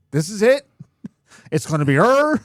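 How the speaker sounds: loud, high rallying cry; hammed-up loud stand, hard final R; mock last stand. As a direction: declare it defiantly, leaning on the R. Voicing Philadelphia's last stand for R.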